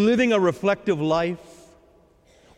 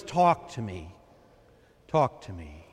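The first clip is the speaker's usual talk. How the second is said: much lower and softer, fading; tender Lord; 13 semitones lower, 6 dB quieter than usual. Acted. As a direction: gently coax the burdened one to speak.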